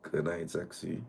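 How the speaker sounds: low-volume seductive greeting; soft flirty murmur. Retold or imitated. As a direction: say it softly, like a flirt. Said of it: "softly say".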